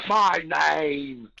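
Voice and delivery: hammed-up English villain voice in a British accent; Cumberbund's English accent. Mocking the new Khan actor in an English accent. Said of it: "with his English accent".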